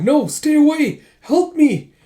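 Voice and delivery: 악몽에 시달리듯 겁에 질린 외침; high, loud nightmare plea for help; frightened cry. Acted.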